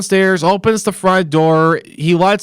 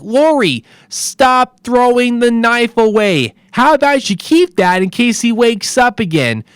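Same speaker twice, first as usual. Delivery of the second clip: higher, pleading advice to the screen; exasperated plea; 6 semitones higher than usual. Retold or imitated. Viewer pleading with Lori on screen to keep the knife, exasperated.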